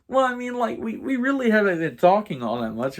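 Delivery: high-pitched retold pleading; awkward coaxing. Nudging sleepy roommate into a talk, high coaxing voice. Acts out coaxing his reluctant friend.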